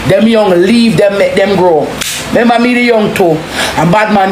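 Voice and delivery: ordinary delivery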